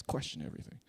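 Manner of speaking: whispered slogan; ominous whisper. Pretend. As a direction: breathe the slogan like a thriller tagline. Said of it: "somebody's checking the tire tracks".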